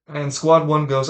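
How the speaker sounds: ordinary talk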